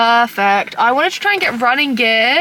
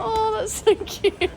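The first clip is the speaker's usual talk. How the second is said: cooing; 10 semitones higher, slower than usual. Real, not acted. Cooing at cute item.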